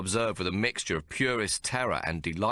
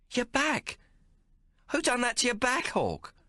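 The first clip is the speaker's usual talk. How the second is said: shocked. A narrator's reading. Shocked at scarred back.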